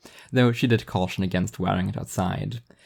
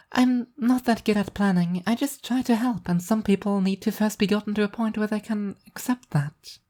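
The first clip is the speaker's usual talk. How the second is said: high, bright cheerful angel voice; an upbeat angel showing off her plan; high, cheerful divine woman; cheerful angel Arbitrage; 12 semitones higher than usual. A narrator's reading.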